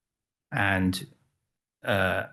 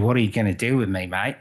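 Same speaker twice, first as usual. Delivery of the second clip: put-on Australian accent, quick and a little louder; 4 dB louder, faster than usual. Ballerina asks photographer what he plans, imitated Australian accent. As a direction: mimic an Australian ballerina, lightly hammed up. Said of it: "Sorry about the accent".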